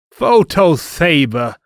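high loud attack shout; slashing battle cry. Acted. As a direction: shout it as you strike. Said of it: "he slashed".

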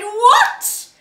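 high, loud outraged cry; doctor exclaiming at the wolf, loud and shocked. A narrator's reading. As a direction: shout it in shocked disbelief. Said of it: "The doctor cried".

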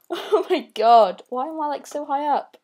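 normal talking voice

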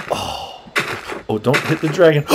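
ordinary talk, nothing marked

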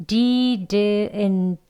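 "Didn't" with clear d sounds and a final t; tongue tip on the ridge for each d; careful didn't. Shown as a demonstration. Teacher models careful "Didn't" for learners. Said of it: "계속 치경에 닿아서 발음이 되겠죠".

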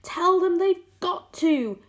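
high, loud, slow insistence; urgent shout. A narrator's reading. James answering the centipede below, urgent shout. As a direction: call down firmly and slowly.

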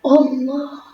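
sudden loud frightened exclamation; startled cry. Real, not acted. Startled by a passing train, loud frightened cry. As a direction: jump at the scare. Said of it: "Arkadaşlar çok korktum".

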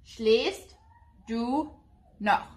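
"Schläfst du noch" said slowly, word by word in German; German pronunciation. Shown as a demonstration. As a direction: spell out "Schläfst du noch" with pauses between words. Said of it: "So in German would be".

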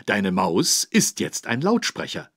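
normal talking voice

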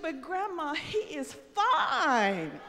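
swooning granddaughter. Retold or imitated. Granddaughter swooning to grandma over The Rock. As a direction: stretch out fine like a smitten kid. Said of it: "She said".

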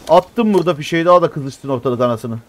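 ordinary talk

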